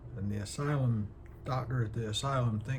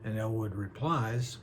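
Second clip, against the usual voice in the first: usual speaking voice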